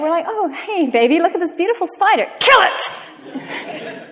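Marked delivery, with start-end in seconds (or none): 0.3-2.3 sing-song sweetness, pitched higher, covering panic; fake calm downplaying. Parent hiding fear of a spider from the child, mock sweet. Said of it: "we try and downplay it". 2.4-4.1 sudden squeaky high cry, breaking the calm act; mock panic. Parent dropping the calm act at the spider, mock panicked squeal. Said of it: "we try and downplay it".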